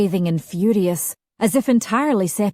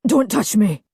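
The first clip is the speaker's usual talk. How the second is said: hoarse plea; 3 semitones higher, 4 dB louder than usual. A narrator's reading. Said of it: "he said hoarsely".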